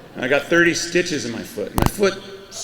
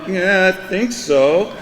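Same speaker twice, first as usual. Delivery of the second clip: slow, higher, dazed reply in a put-on Florida accent; 5 semitones higher, 4 dB louder, slower than usual. Answering the clerk after the lightning, slow dazed Florida accent. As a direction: answer in the local accent, still shell-shocked. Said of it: "'cause the accent plays into the whole thing".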